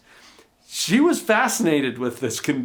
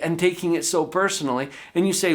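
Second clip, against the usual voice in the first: usual speaking voice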